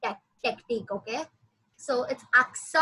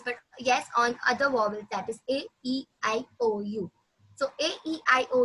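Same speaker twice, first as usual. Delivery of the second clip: normal talking voice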